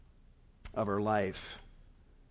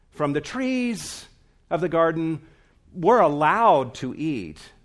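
mocking quote; 3 semitones higher, 5 dB louder than usual. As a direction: caricature Eve's half-hearted allowance. Mocking Eve's grudging allowance quote.

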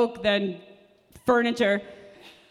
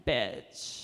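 much lower and softer under-breath bitch; low muttered aside; muttered insult; 14 semitones lower, 9 dB quieter than usual. Acted. Server's muttered parting insult.

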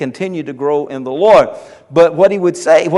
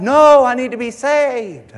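imitating the man; 7 semitones higher than usual. Man at the altar asking the preacher, mildly pleading. Same man returns next night.

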